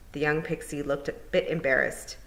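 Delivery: plain reading voice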